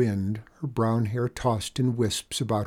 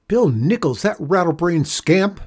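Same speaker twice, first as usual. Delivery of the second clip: higher, louder angry outburst; exclaiming; 8 semitones higher, 7 dB louder than usual. Acted. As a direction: scold the absent scamp. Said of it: "the mother exclaimed".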